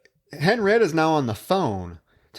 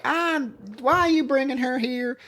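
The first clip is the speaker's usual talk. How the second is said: much higher, indignant; shrill upset wife; 13 semitones higher than usual. Retold or imitated. Wife objecting to the sheriff bringing the girl, high and indignant. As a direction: squawk at her husband. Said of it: "she's like".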